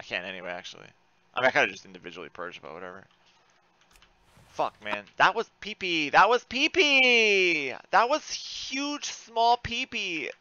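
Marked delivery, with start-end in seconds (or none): 6.1-10.3 slow, stretched and higher; slow drawl. Real, not acted. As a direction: draw each word out mockingly. Drawn-out mock remark.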